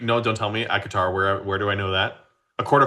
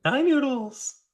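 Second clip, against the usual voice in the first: much higher, slow sing-song pet talk; talking to a cat; 14 semitones higher, slower than usual. Real, not acted. Host greeting the cohost's cat, cooing pet voice.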